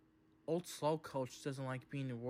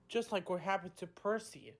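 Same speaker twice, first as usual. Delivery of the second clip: ordinary delivery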